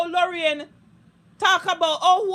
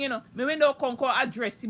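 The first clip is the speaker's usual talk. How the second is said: ordinary talk, nothing marked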